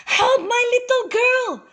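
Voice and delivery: very high, panicked plea; anxious cry. A narrator's reading. Mother hurrying her bitten child to Moses, anxious cry. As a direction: cry out high, desperate for help. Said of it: "cried the mother anxiously".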